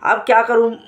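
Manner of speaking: ordinary talk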